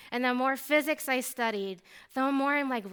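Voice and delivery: ordinary talk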